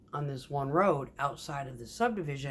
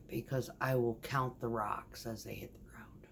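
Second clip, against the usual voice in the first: whispered threat; 4 semitones lower, 8 dB quieter than usual. Retold or imitated. Quiet slow-pour threat.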